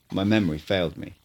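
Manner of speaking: plain reading voice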